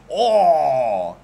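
loud oh of amazement; sudden loud oh at the picture; loud surprised oh. Real, not acted. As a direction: let out a loud impressed oh. Sees new drawing.

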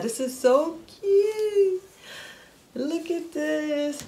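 high, slow and teary with delight; happy tears. Real, not acted. Vlogger near tears unboxing her new cookware, teary and high. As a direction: choke up over how cute it is. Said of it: "I'm about to cry".

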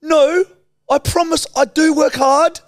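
put-on very high, loud New Zealand accent; New Zealand influencer. Influencer insisting they work hard, high New Zealand whine. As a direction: protest in an exaggerated high Kiwi voice. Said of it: "influencer from New Zealand who pretends to work".

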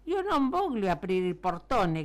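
loud, emphatic storybook shout; shouted refusal. A narrator's reading. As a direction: shout the refusal across the street. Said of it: "gridò il signore affacciato".

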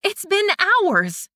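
an exasperated high cry; cried out. A narrator's reading. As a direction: protest it with exasperation. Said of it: "she cried".

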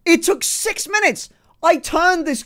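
high, loud triumphant disbelief; excited shouting. Real, not acted. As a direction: exclaim it high and incredulous. Excited shouting about converting viewer.

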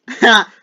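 high, loud single laugh burst; triumphant ha. Pretend. Player scoring on a game foe, triumphant ha.

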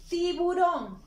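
excited naming of the picture; bright announcing. Shown as a demonstration. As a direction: announce Tiburón brightly to the class. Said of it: "Está iniciando con la sílaba ti".

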